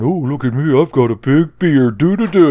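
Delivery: sing-song taunt in a put-on voice; teasing cartoonish brag; mocking Chris. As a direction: play a silly show-off, pitch climbing at the end. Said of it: "he heard Chris going".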